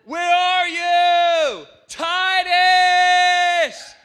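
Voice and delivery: full loud shout across a store, pitched high; yelling a name. Retold or imitated. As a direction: call out desperately for a lost child, at full volume. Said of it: "I began yelling extremely loud".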